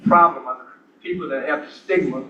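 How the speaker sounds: ordinary delivery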